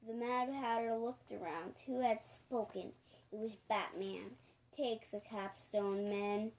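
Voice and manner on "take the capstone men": ordinary delivery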